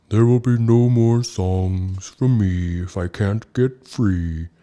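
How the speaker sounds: mournful whale, drawing each word out; soulful whale. A narrator's reading. Ooga tells Snow Bear he must be freed, soulful. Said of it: "Ooga sang soulfully".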